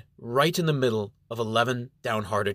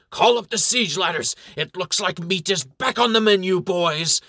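raised, high battle cry from a worn-out voice; exultant strained shout; 6 semitones higher, 4 dB louder than usual. A narrator's reading. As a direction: shout with what voice you have left. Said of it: "shouts with what is left of his voice".

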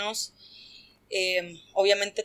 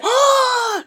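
much higher startled yelp; yelp of surprise; 7 dB louder than usual. Retold or imitated. Guy's wrist grabbed by her, high startled yelp.

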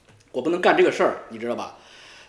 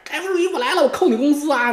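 mock boss bluster; 9 semitones higher than usual. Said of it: "我说".

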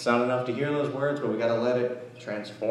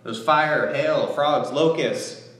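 preacher stirring up the congregation over Israel's golden calf, heated; preaching at full force; 7 semitones higher than usual. Acted. Pastor rebuking the faithless Israelites, fired-up preaching.

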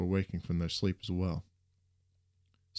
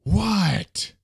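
loud, high angry yell; explosive answer; 13 semitones higher, 9 dB louder than usual. A narrator's reading. Peeved bus driver turns round mid-honk, exploding. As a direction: blow up at the interruption. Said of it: "exploded his answer".

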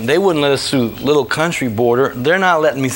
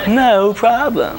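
high, slow, drawn-out delivery; comic slow concession; mock-agreeable reply; 7 semitones higher, much slower than usual. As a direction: stretch it out with exaggerated ease. His reply to the yelling.

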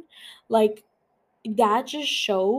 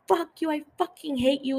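mild mock yell, higher pitch; retold angry scream; 5 semitones higher than usual. Podcaster quoting a wife's phone rant, mock scream. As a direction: imitate a furious wife without raising volume. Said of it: "she was screaming at her".

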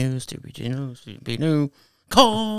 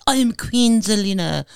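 put-on British accent; 7 semitones higher, slower than usual. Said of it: "got the British accent".

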